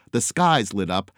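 ordinary delivery

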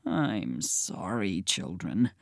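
low, soft, slow growl; growl-oink. A narrator's reading. Pig apologizing to the children, low soft growl. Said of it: "Genius Kelly growl oinked".

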